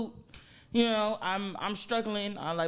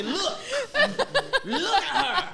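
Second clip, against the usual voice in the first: laughing; 9 semitones higher, slower than usual. Retold or imitated. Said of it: "I said".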